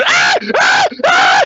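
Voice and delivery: very high pained yelps; pained screams. Acted. Wounded Neil screams as the bullet comes out, very high. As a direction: cry out in pain. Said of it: "Neil is making noises".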